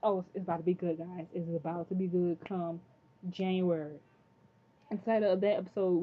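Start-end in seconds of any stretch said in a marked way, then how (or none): none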